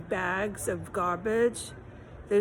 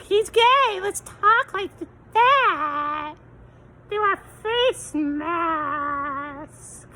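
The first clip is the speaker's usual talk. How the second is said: put-on much higher, loud sing-song baby voice, slowing to a drag at the end; mocking baby talk; 8 semitones higher, 6 dB louder, much slower than usual. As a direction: parody the locals cooing at him through a mask. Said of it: "They all talk like that here".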